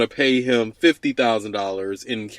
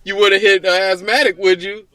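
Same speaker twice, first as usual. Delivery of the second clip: mock pleading, higher pitch; 7 semitones higher, 3 dB louder than usual. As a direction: beg in a raised voice.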